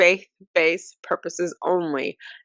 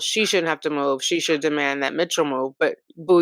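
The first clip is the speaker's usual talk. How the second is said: ordinary delivery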